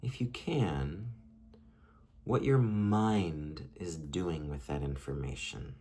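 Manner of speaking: unhurried guiding voice with long pauses, softening as it goes; guided meditation. Acted. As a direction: lead them gently inward, leaving space between phrases. Leads a short guided meditation.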